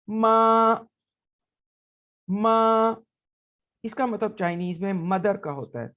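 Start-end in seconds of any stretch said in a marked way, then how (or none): 0.1-3.0 माँ held level and high, said loud; first tone. Shown as a demonstration. Teacher demonstrating the level first tone on माँ. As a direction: sing माँ on one flat high pitch. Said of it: "तो देखते हैं कैसे अदा करना है".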